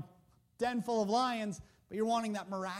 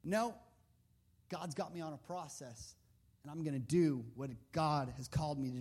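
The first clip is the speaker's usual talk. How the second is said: quiet resolve; 8 semitones lower, 6 dB quieter than usual. Retold or imitated. Daniel refuses again.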